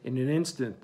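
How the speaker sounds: usual speaking voice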